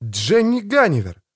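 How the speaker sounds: high-pitched startled exclamation; amazed, voice leaping up; astonished gasp. A narrator's reading. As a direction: blurt the name out in surprise. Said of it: "ахнул Том".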